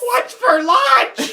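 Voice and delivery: very high, drawn-out shriek; screamed catchphrase. Pretend. Friend interrupting the story, high screamed catchphrase.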